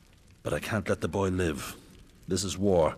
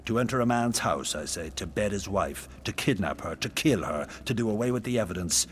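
loud, driving accusation; angry tirade; 3 semitones higher, 5 dB louder than usual. Acted. King listing the Trojans' crimes, angry and loud. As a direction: hammer each charge louder.

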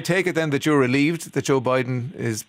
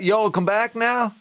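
higher, slow Texan drawl; mock Texan drawl; 5 semitones higher, slower than usual. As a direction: stretch it out like a movie Texan. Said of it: "So is, is that".

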